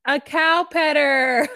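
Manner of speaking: cracking up, very slow and loud; laughing out loud. Real, not acted. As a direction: burst into real laughter mid-word. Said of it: "laughing like that in that mic".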